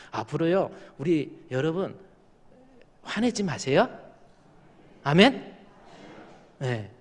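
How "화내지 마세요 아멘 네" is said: usual speaking voice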